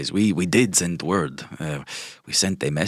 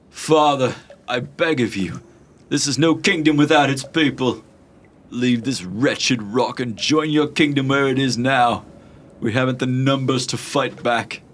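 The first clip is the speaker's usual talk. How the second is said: put-on higher pleading young prince voice; 8 semitones higher than usual. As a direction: beg your father earnestly. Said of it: "You hear him say".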